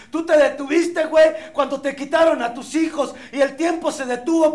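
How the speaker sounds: building from plain to a loud, shouting tirade; furious rant. Retold or imitated. Padrino berating sponsee, rising rant. (sermón furioso del padrino, cada vez más gritado)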